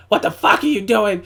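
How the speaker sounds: high, loud mock yell of an irate coach; yelling cornerman. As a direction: imitate a trainer tearing into his fighter. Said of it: "It's not just about purely yelling".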